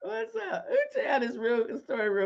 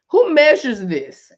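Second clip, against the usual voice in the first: louder punchline question; exclaiming; 3 semitones higher, 9 dB louder than usual. Real, not acted. Joking to viewers about hot flashes, loud. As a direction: throw the joke out.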